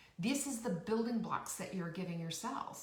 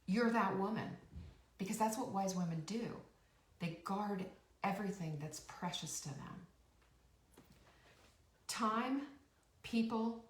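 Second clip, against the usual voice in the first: normal talking voice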